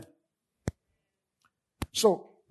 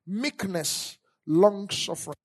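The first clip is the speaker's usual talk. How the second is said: ordinary talk, nothing marked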